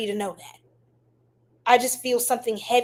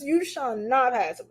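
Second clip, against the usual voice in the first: lighter Gandalf echo; slower than usual. Retold or imitated. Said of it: "And like Gandalf".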